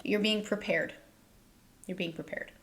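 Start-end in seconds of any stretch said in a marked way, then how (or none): 1.9-2.5 much softer, almost to herself; quiet echo of the last line; soft repeat. Shown as a demonstration. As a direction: let the repeat sink down soft. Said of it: "repeated reassurance, second softer".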